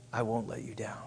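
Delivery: a hushed, breathy promise; whispered vow. Acted.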